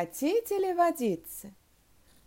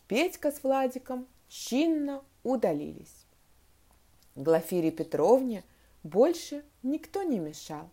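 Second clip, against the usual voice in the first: usual speaking voice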